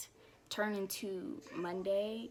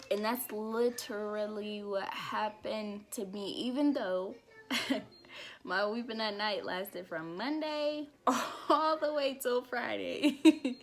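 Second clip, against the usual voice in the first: usual speaking voice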